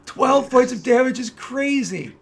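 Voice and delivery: loud, high, drawn-out awe; real thrilled exclaiming; amazed excitement. Real, not acted. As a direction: savour a crushing hit with loud delight. Reacting to a big damage roll.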